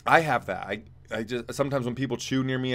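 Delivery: ordinary delivery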